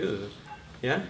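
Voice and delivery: ordinary delivery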